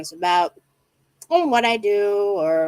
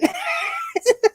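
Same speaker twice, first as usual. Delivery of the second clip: high shrieking laugh. Real, not acted. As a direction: shriek with delight. Big laugh about fellow Ohioan.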